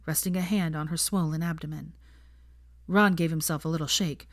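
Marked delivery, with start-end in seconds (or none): none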